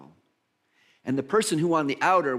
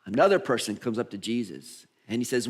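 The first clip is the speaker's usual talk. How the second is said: ordinary talk, nothing marked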